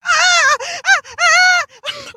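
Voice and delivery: piercing high shrieks; shrieking cry. A narrator's reading. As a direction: let out emotional shrieking cries. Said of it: "loud and more emotionally charged shriek".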